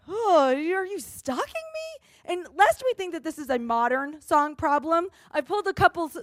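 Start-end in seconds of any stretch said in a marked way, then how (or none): 0.1-2.1 wary disbelief. Pretend. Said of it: "And you think".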